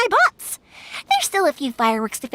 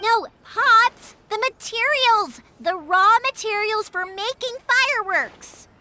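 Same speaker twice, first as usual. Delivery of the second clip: slow, deliberate explaining; 5 semitones higher, slower than usual. Real, not acted. Explaining to deaf father.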